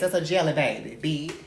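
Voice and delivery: ordinary delivery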